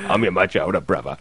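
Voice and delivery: a hint of Michael Caine, tender and fatherly; Michael Caine impression. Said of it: "Was my Michael Caine okay".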